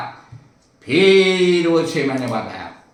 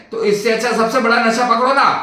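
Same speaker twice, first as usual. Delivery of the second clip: higher, rousing push; emphatic punchline; 6 semitones higher than usual. Real, not acted. Doctor urges his audience toward God's name, rising and emphatic. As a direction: sell the punchline with a lift. Punchline urging the biggest addiction.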